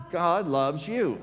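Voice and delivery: slow, loud, high shout. Real, not acted. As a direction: call it out slowly across the church. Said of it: "I want you to shout out to them".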